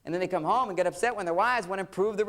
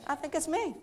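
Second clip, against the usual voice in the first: high wife voice; 9 semitones higher, 4 dB quieter than usual. Retold or imitated. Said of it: "She goes".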